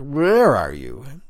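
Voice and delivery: slow, loud, slurred and rising; a slow drunken call toward an unseen voice; drunk slurring. Acted. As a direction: drag the words out drunk and searching. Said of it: "I added as I looked".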